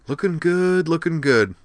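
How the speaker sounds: high, loud breezy self-praise. Acted. Rena admiring her own reflection, high and breezy. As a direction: admire yourself in the mirror. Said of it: "went on breezily".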